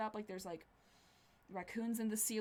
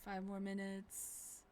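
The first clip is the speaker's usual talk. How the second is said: groggy mumble; 7 dB quieter, much slower than usual. Acted. Groggy waking up.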